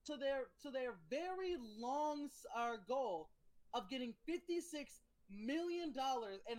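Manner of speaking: MrBeast voice. Pretend. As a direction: open a video like MrBeast. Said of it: "Mister Beast".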